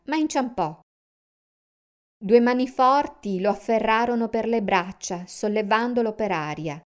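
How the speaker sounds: ordinary delivery, nothing marked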